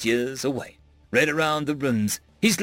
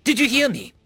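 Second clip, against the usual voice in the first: sharp, insistent, high question; demanding; 11 semitones higher, 3 dB louder than usual. A narrator's reading. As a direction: demand an answer. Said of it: "I demanded".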